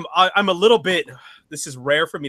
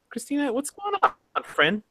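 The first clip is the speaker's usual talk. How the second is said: ordinary talk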